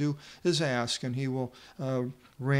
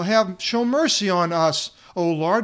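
loud, higher plea; yelling for mercy; 4 semitones higher, 6 dB louder than usual. Retold or imitated. Blind men calling to passing Jesus, loud pleading yell. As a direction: call it over the noise of the crowd. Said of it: "They're yelling out".

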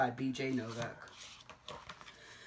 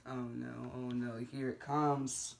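hushed, dreading oh no; quiet dread; 4 semitones lower, 6 dB quieter than usual. Pretend. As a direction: murmur it, bracing yourself. Dreading the silly sounds.

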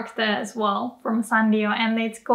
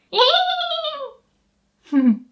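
even higher, longer whinny; bigger horse whinny. Shown as a demonstration. Teacher gives a better horse whinny. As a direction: neigh fully like a horse. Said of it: "I could do more like".

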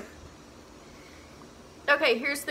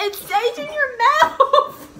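laughing; 12 semitones higher, 6 dB louder, much slower than usual. Real, not acted. Gross taste lingering, laughing.

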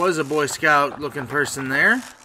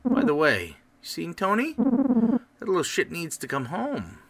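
higher, scolding character voice; brash money-cadging game character; 8 semitones higher, 6 dB louder than usual. Acted. Game character pestering Brad for spare change, high-pitched and scolding. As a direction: pitch it up like a nagging acquaintance.

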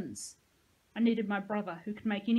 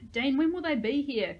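complaining, a bit louder and higher; whiny complaint; 5 semitones higher, 7 dB louder than usual. A narrator's reading. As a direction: whine it like a bored kid. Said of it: "Chloe complained".

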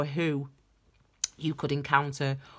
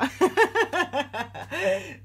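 laugh; 10 semitones higher, 5 dB louder than usual. Real, not acted. Laughs at own crying.

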